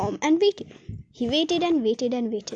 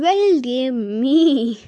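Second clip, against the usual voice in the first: awkward Medal; 6 dB louder, much slower than usual. A narrator's reading. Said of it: "feeling very awkward about it".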